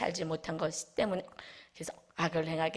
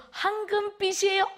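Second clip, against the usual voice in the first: much higher, lifted with joy; joyful answer; 12 semitones higher than usual. Retold or imitated. Congregant tells the pastor her heart's colour, joyful. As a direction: answer with radiant delight.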